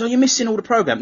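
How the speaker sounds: high mock-whiny wife voice; lightly mimicking his missus, pitched high; wife's complaint. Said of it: "and she goes".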